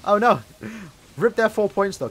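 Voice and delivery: loud, high game outburst; excited shout. Real, not acted. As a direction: yell at the screen. Game reaction.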